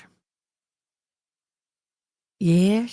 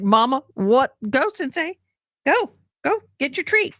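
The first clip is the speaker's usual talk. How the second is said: put-on higher, excited kid voice; imitating her son; 6 semitones higher than usual. Son calling the dog out the door, higher and excited. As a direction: squeak out the son's call to the dog. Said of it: "My son probably is like".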